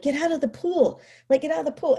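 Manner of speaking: high, exasperated command; mildly acted angry parent; retold furious order. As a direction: order a child out, replaying your anger. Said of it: "I was furious".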